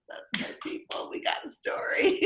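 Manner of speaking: choked-up, breathy teary voice. Retold or imitated. Moved by the bride's forgiving reply, teary and choked up. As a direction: let tears catch the words.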